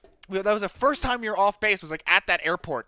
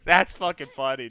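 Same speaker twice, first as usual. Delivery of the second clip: normal talking voice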